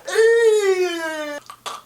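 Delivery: very high, drawn-out grossed-out squeal. Retold or imitated. Kid face to face with a huge spider, high squeal. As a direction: squeal loudly in mock horror.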